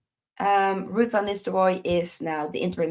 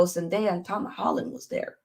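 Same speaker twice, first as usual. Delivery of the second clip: ordinary delivery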